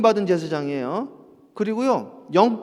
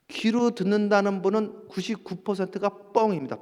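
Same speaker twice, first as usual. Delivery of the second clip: ordinary talk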